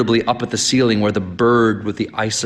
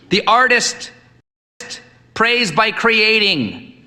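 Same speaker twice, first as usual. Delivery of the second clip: higher, slow, testing; tentative young man; 12 semitones higher, slower than usual. A narrator's reading. Son cautiously offering his idea to the priest. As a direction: venture it carefully. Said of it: "Asbury ventured".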